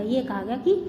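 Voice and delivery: ordinary talk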